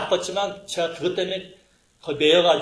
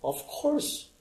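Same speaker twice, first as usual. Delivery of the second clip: bank clerk; 10 semitones higher, 5 dB quieter than usual. Retold or imitated. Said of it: "그랬더니 그 여자가".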